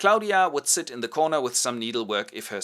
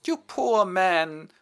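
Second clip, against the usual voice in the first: very slow, louder pitying croon; mock pity; 10 semitones higher, 6 dB louder, much slower than usual. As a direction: drip with fake sympathy. Countess feigns pity.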